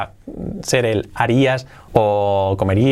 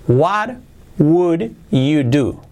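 "What would you do?" very slow, word by word; slow word-by-word model; much slower than usual. Shown as a demonstration. Teacher models the question for Spanish-speaking learners, slow and separated.

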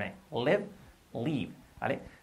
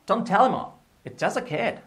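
"tell him off" sung out high and louder; expressive intonation; 8 semitones higher, 5 dB louder than usual. Shown as a demonstration. Teacher models phrase with intonation, TELL pitched high. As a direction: TELL and JUST lifted high in lively tune. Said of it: "Con entonación también".

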